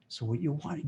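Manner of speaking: usual speaking voice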